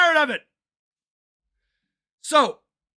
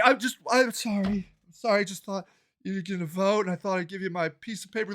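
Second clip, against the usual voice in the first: meek apology; 6 semitones lower, 8 dB quieter, faster than usual. Retold or imitated. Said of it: "'cause I'm like".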